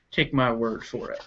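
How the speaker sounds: usual speaking voice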